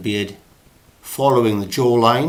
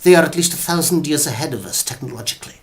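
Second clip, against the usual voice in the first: Professor Quatermass; 6 semitones higher, 3 dB louder than usual. Acted. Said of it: "Or Professor Quatermass".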